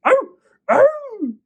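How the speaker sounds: high, loud pained yelps; wolf yelping in pain. Acted. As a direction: yelp as the burning brand bites. Wolf burned by firebrand.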